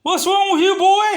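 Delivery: very high, loud mock yell; outraged seller. Seller berating the customer who called, outraged. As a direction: imitate the seller's outrage at full pitch. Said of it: "And he's like".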